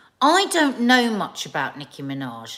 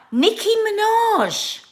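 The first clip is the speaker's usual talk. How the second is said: high, loud, drawn-out cry; sing-out, stretched and high; big topic announcement; 13 semitones higher, 6 dB louder, slower than usual. Real, not acted. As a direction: belt out the name like a grand announcement. Announces the next topic with relish.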